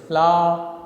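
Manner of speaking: "flower" broken into two syllables, flow-er; split into syllables. Shown as a demonstration. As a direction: say "flower" loudly in two separate beats. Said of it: "flower has two syllables".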